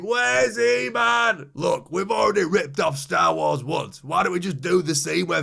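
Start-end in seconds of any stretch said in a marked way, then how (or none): 0.0-1.5 mock scream. Henchman screaming for He-Man on every corner, drawn-out yell. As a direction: bellow it down the street slowly. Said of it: "just have him scream".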